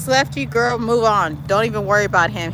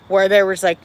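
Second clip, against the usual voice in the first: normal talking voice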